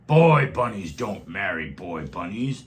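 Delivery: the stink bug forbidding the bunnies, slow and scary. A narrator's reading. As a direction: lay down the law, slow and threatening. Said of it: "said the stink bug".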